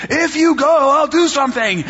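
high, loud mock shout; God shouting. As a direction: shout God's promise, half joking. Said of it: "He was, like, shouting at me".

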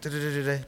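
low, soft da-da-da mumble; mumbled filler. Retold or imitated. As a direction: hum it under your breath, busy. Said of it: "he's like".

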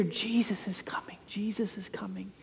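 hushed whisper passing through a crowd. Retold or imitated. Crowd murmuring to each other that Jesus is coming, whispered. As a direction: breathe it like a secret rumor.

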